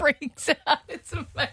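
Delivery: breathless giggling; laughing. Real, not acted. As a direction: laugh through the words. Laughing while speaking.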